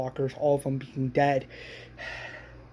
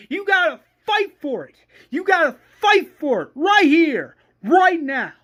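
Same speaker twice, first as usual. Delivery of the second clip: high, loud, furious rant; screamed tirade; 12 semitones higher, 7 dB louder than usual. Acted. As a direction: scream a rallying demand at the group. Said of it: "that's where Shane screams".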